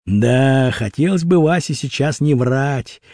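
plain reading voice

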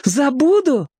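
put-on very high, childlike question; boy's voice. A narrator's reading. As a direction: voice a boy echoing in disbelief. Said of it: "сказал мальчик". (высоким голосом мальчика)

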